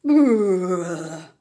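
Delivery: teeth-chattering brrr; shivery brrr. Acted. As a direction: shiver out loud through the lips. Sheep shivering with cold.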